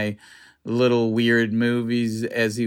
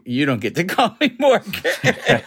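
higher pitch, breaking into a laugh; laughing retold quote; 8 semitones higher than usual. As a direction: retell the mock objection through laughter.

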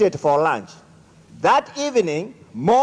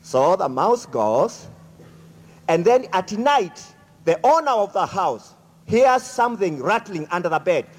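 ordinary talk

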